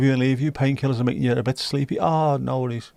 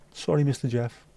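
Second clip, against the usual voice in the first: usual speaking voice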